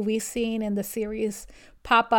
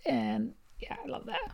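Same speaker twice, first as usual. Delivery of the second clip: whisper; 10 dB quieter than usual. Real, not acted. Soft aside after a sweet ending.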